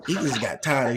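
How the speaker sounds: normal talking voice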